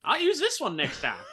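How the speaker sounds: high in-character call. Acted. Player's character plans to use the magic staff, high call. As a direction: pitch it right up, playfully in character.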